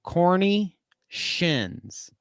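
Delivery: very slow "corny shins", stretched out; slow mocking "corny shins"; savouring the misspelling. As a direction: drag out "corny shins" word by word. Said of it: "but it said".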